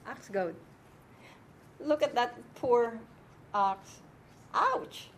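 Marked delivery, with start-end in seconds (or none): 4.5-5.1 a sharp, high sympathetic ouch; mock ouch. Teacher winces on behalf of the poor ox, high.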